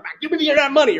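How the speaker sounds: loud, high, pushy demand for the money; demanding shout. Acted. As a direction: bark at the debtor to pay up. Servant demands money from debtor.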